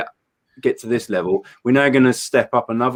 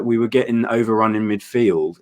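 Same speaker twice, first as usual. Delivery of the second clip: ordinary talk, nothing marked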